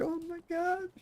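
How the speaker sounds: very high imitation of an embarrassed girlfriend; high-pitched girlfriend voice; mock mortified squeal.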